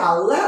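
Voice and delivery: আল্লাহ as "alla", questioning rise; rejected pronunciation. Shown as a demonstration. Teacher testing a wrong form, আল্লাহ as alla, rising. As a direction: ask আল্লাহ with doubled l, rising. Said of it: "না".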